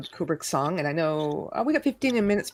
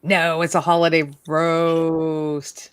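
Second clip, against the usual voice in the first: game show host; 3 semitones lower, 4 dB louder, much slower than usual. Acted. Game show answer reveal.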